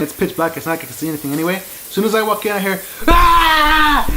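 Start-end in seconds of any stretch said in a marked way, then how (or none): 3.1-4.1 loud, high-pitched startled scream; imitated scream. Dad screaming as son walks in, imitated scream. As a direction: scream out in sudden fright, imitating someone. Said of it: "my dad screams".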